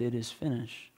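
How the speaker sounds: very soft, hushed and falling; a reverent near-hush at the cross. A narrator's reading. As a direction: let it fade almost to nothing. Solemn retelling of Jesus' last words.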